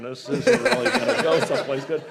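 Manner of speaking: anxious thought with a laugh in it; laughing worry. Retold or imitated. MC thinking during the coach's story, laughing. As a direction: laugh through the dread.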